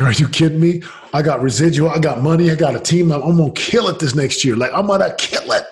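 loud, high, fired-up bragging; excited disbelief. Retold or imitated. Rejecting the voice to quit, in loud excited disbelief. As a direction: pump yourself up like you can't lose.

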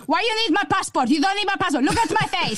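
put-on high, bossy Portuguese mum accent at border control; demanding in her mother's Portuguese accent; mum's Portuguese accent. Daughter mimicking her mum to a border guard, in a Portuguese accent. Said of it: "I'll just do my mum's accent".

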